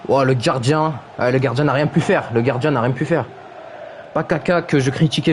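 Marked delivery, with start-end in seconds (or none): none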